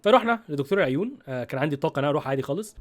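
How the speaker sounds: ordinary talk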